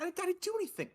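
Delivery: high-pitched, stammered denial; defensive guard. Acted. Confused guard answering another guard, high-pitched denial. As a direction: plead innocence in a squeaky high voice. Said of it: "He's like".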